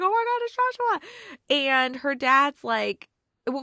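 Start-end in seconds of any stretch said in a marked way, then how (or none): 0.0-1.1 high excited squeal of recognition; freaked out. Retold or imitated. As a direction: retell the fan-girl freak-out, much higher. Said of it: "freaked out when I was like".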